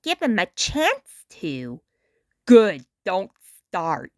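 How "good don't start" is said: put-on lower, laid-back old star voice; a worn-out celebrity talking down to a kid; Muddy Mud Skipper.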